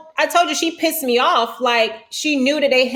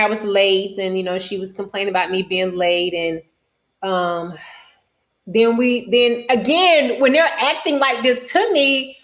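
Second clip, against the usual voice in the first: ordinary delivery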